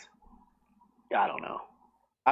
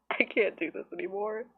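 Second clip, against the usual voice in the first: laughing; 8 semitones higher than usual. Real, not acted. Flubbed joke, laughing at herself.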